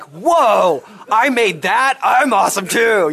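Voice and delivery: God gushing loud and high over his own work; mock God. God marveling at the missionary he made, giddy and loud. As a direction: play God as a giddy, boastful creator. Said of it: "And God is gonna be like".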